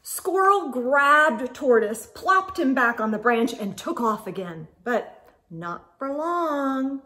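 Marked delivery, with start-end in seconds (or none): none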